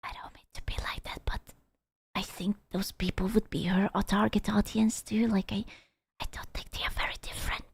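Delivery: barely voiced, near-whispered hedge; hushed aside. Real, not acted. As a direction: drop to a very soft, hesitant whisper. Hesitant aside, careful not to offend.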